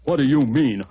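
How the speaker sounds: angry bear. Acted. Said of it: "the bear got very angry".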